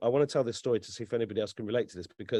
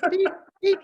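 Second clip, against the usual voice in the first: high-pitched imitated scanner beeps; squeaky electronic beep, done by mouth; robot beeps; 4 dB louder than usual. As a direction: beep like a malfunctioning machine. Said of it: "It's a malfunctioning robot".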